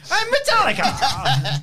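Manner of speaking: high, slow, drawn-out comic cry; Ken Jeong imitation. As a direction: stretch it out high and loud for laughs. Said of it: "he was like".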